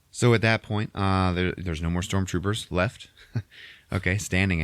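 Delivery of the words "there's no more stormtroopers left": ordinary delivery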